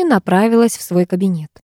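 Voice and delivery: ordinary delivery, nothing marked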